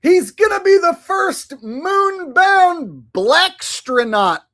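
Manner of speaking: high, loud, drawn-out hollering; over-the-top mocking shout; mock-excited yell. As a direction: holler it slowly in exaggerated amazement. Mocking the news report.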